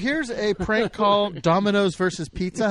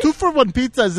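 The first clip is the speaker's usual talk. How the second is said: put-on Indian accent; 4 semitones higher, 4 dB louder than usual. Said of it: "Sorry for the accent".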